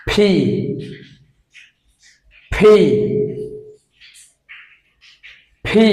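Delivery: ফি said as a breathy puffed p sound; P phonics sound. Shown as a demonstration. Teacher models the right P sound for students. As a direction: puff out the P sound. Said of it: "right pronunciation is".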